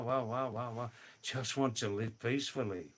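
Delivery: normal talking voice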